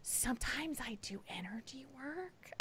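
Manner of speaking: unvoiced whisper with a lilting rise at the end; whispered imitation. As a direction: mimic her mystical hushed sales pitch. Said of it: "the way she said it too".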